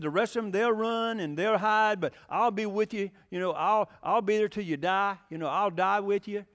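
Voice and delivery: lightly voiced macho Peter vowing; boastful Peter. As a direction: swagger through the loyal boast. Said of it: "He said".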